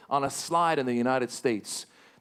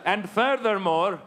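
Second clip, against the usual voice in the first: mock formal statement; 5 semitones higher than usual.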